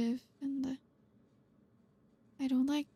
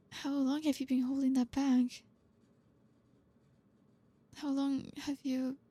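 ordinary talk, nothing marked

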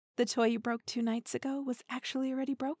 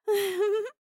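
high bright teasing giggle; playful high laugh; 10 semitones higher, 8 dB louder than usual. Acted. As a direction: giggle at him, delighted. Teasing lover giggles at reaction.

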